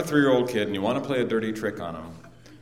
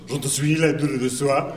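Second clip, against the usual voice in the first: mock French gibberish; 4 semitones higher than usual. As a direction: fake French driver babble. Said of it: "The driver turns and goes".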